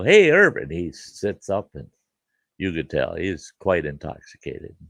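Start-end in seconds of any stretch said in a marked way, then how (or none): 0.0-0.6 high, loud hey to wake him; calling out. Retold or imitated. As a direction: call out to rouse a sleeping man. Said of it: "I go".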